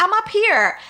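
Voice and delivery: high call down from above. Retold or imitated. Leaning out the window to the electric man, high hailing call.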